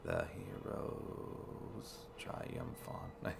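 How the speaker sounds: whispered slowly and softly; whispered haiku. Shown as a demonstration. Player whispering the haiku line slowly to himself. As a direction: breathe "The hero's triumph on" in a slow whisper. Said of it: "Is that a haiku?".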